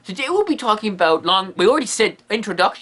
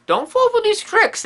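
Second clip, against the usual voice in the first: mock warning; 12 semitones higher than usual. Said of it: "you better say".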